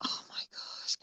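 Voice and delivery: breathy whispered reaction; under-the-breath whisper; hushed whisper. Real, not acted. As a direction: whisper it, barely there.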